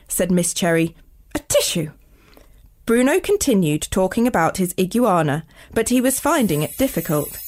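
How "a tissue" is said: quick, light mock sneeze; a small spoken "atishoo"; acted sneeze. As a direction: sneeze the word briefly. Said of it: "everyone in the classroom was sneezing".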